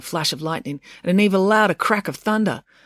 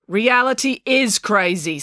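smug; 5 semitones higher, slower than usual. A narrator's reading. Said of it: "said April smugly".

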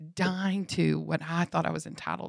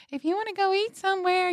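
high, louder mock announcer; stage announcer voice; 5 dB louder than usual. Friends mimicked from the stage, high sing-out announcer voice. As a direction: call it out high like someone on stage.

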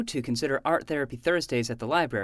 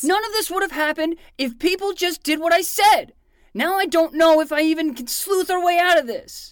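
louder and higher, bitter; frustrated outburst; 11 semitones higher, 7 dB louder than usual. Acted. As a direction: vent it like the world let you down.

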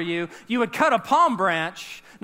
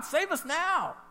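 pleading cry; 8 semitones higher, 5 dB quieter, slower than usual. Shown as a demonstration. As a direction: Save us now lifted much higher, pleading. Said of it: "It means".

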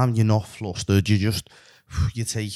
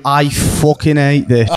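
slow, louder grumbling take-off; mock moaning; 5 semitones higher, 6 dB louder, slower than usual. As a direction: drag it out like a fed-up moaner. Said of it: "And here is you".

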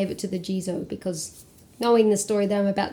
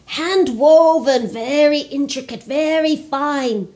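calling out; 7 semitones higher, 4 dB louder than usual. Acted. Old man crying his wares at the busy market, loud sing-song call. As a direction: hawk the cloth to the passing crowd. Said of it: "he called out".

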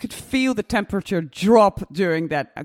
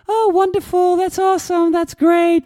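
high, loud, gushing praise; over-the-top flattery; 11 semitones higher, 6 dB louder than usual. Retold or imitated. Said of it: "instead of only going for".

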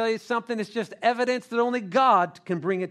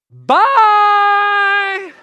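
loud, high, long-held yell; comically panicked holler; fleeing shout; 12 semitones higher, 12 dB louder than usual. Acted. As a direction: shout a drawn-out goodbye as you bolt. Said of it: "you know what I would have said?".

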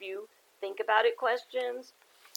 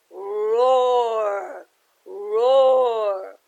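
long, loud drawn-out roars; slow building lion roar; 11 dB louder, much slower than usual. Acted. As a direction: roar in distress like a trapped lion.

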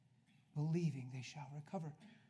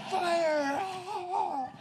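high, loud cry of fire; one-word preacher's shout; fiery shout; 12 dB louder than usual. Acted.

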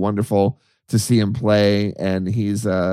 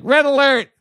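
high, louder red alert call; mock alarm; 14 semitones higher, 4 dB louder than usual. Joking emergency alert email, high mock alarm. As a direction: sound the alarm, half joking. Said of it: "like, emergency alert".

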